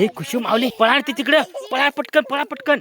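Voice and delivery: high, loud call to run; panicked urging. Pretend. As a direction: hurry the kids away. Owner arrives, urging children to flee.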